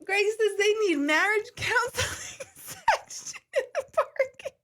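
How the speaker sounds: high, squeaking words through laughter; trying to tell it but laughing too hard; laughing fit. Real, not acted. Telling chat Greg's joke, laughing helplessly.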